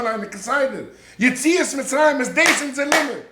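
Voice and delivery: high, loud sermon delivery; impassioned preaching. Real, not acted. (הויכע, פֿײַערדיקע דרשה)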